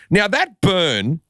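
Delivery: ordinary delivery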